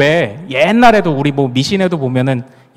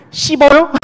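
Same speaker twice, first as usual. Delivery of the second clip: high-pitched Gyeongsang 씹어요; Gyeongsang dialect; 9 semitones higher than usual. Shown as a demonstration. Pastor repeating Gyeongsang word for bitter, dialect. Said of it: "이렇게 얘기하죠".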